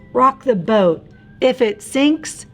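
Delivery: ordinary delivery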